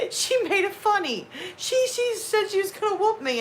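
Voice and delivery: high, sneering kid imitation; mocking kid voice. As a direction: mimic a smug kid laughing it off. Kids mocking a parent's threat.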